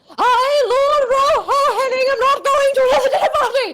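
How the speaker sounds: high, fast wailing lord; quoting her husband. Retold or imitated. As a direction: wail it like a fed-up husband. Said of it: "and he went".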